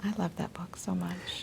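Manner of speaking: soft whispered aside; whispering. Real, not acted. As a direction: breathe it fondly, almost to yourself. Fond aside about a book.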